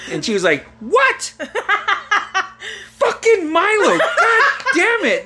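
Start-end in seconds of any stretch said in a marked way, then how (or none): 0.8-1.4 very high, incredulous mother; mock outraged disbelief. Mother reacts to Santa revelation. 3.0-5.3 high, drawn-out exasperated cursing; imitated furious mother. Mother curses son after revelation.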